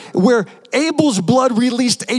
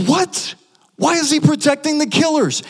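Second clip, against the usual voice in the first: higher, incredulous what; mock surprise; 6 semitones higher than usual. Preacher feigns disbelief at Jesus protecting killers, incredulous. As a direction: act baffled, pitch shooting up.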